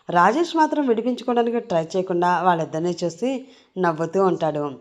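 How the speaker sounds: usual speaking voice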